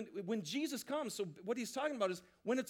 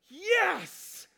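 excited yes; 7 semitones higher, 7 dB louder, much slower than usual. Real, not acted. Reacting to trophy image.

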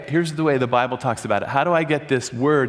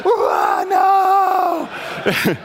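a sudden high shouted "Ah, no!"; loud anguished cry; 4 dB louder than usual. Retold or imitated. Retelling a man's outburst, a shocked shouting cry. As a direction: cry out in high-pitched protest. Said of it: "And he did".

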